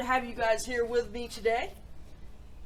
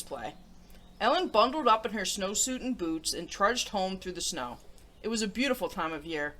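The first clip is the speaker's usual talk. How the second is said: put-on reading a picture book to children; storybook read-aloud voice; story narration; 4 semitones lower, 4 dB louder than usual. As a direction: tell it gently to young listeners.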